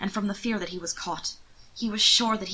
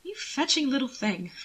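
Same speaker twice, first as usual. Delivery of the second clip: higher, charmed and amused; 7 semitones higher than usual. Acted. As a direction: coo it with a chuckle. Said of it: "That made him laugh, thoroughly amused".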